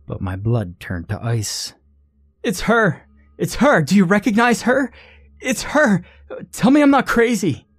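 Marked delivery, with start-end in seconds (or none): 2.4-7.7 high, frantic, terrified cry; panicked scream. Acted. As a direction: shriek it in sheer panic. Cindy panics at the corpse.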